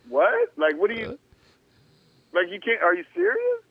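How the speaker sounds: high, loud shocked questions; shocked disbelief. Retold or imitated. Player retelling being cut, shocked high disbelief. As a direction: squeak out your disbelief.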